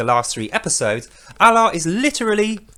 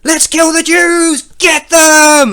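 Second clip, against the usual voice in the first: high, very loud, slow battle yell; rally the charge; hammed-up bloodthirsty cry; mock war shout; 10 semitones higher, 9 dB louder, much slower than usual. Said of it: "Allah is going".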